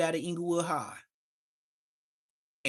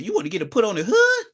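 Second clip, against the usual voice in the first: surprised; 6 semitones higher, 9 dB louder, faster than usual. Retold or imitated. Gramps surprised, phone dropped.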